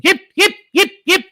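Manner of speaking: very high, very loud yelps; shrieked curler yips. Retold or imitated. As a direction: yelp "Yip" at the top of your lungs. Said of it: "at the top of their lungs".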